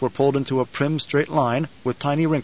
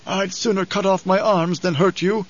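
put-on higher-pitched old man, protesting; devoted aide in a raised old voice; loyal old advisor; 6 semitones higher than usual. A narrator's reading. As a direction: plead loyalty to your master.

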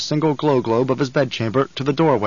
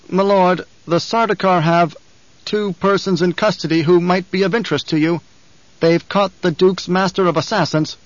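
nervous guard captain; 6 semitones higher, 3 dB louder than usual. Acted.

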